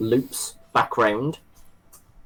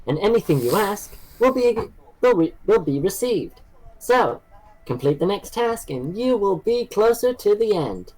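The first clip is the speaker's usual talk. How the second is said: robot test-master with swooping, higher pitch; ominous robot voice; 7 semitones higher than usual. Acted. Game master as the robot congratulates the test subjects, ominous robot voice. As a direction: sing out each announcement as a scripted robot host.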